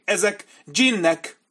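high, slow, fearful voice; frightened insistence. A narrator's reading. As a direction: insist in dread they are djinns.

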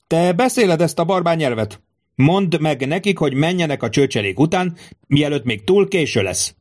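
high-pitched, agitated order; heated demand. A narrator's reading. Tribune demands centurion translate his order, heated demand. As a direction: press the officer urgently.

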